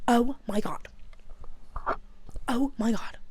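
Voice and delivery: ordinary talk, nothing marked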